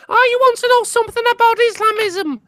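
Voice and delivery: high-pitched mocking impression; Otterface. Mimicking Otterface to show the difference, high and put-on. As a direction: pitch it right up as a mock Liverpool caricature. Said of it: "Otterface is just like".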